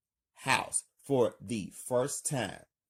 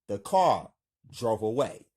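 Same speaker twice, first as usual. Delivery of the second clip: plain reading voice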